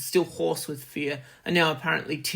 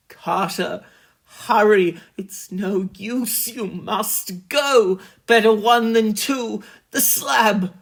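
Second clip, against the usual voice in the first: urgent plea; 5 semitones higher, 7 dB louder, slower than usual. A narrator's reading. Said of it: "his next whisper found me".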